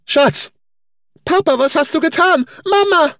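put-on the boy screaming in shock; panicked child. A narrator's reading. Son screaming at his father after the fall, high and loud.

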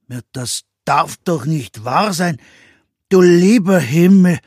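entsetzt aufstöhnend, höher und lauter; stöhnender Meister Eder. A narrator's reading. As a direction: Meister Eder stöhnt beim Anblick der verwüsteten Küche. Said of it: "stöhnte Meister Eder". (stöhnend und laut entsetzt)

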